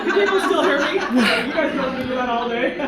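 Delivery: projecting loudly without the mic, laughing. Real, not acted. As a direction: belt it out so the back row hears, chuckling. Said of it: "luckily, I have a loud voice".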